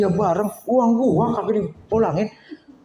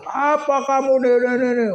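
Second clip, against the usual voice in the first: mock angry; 7 semitones higher than usual. Irritated man snaps at whoever came to bother him, mock angry. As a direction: act out someone riled up, voice pitched high. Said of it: "Kan dia marah tuh".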